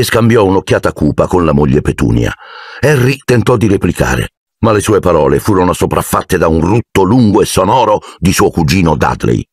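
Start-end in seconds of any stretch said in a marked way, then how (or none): none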